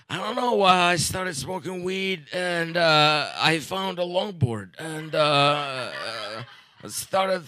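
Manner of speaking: ordinary talk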